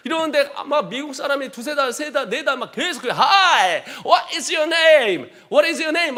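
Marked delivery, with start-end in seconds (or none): 3.1-6.2 teasing American. Retold or imitated.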